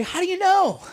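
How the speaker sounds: high-pitched mock challenge; incredulous challenge. Commentator challenging people predicting World War Three, incredulous. Acted challenge in imagined argument.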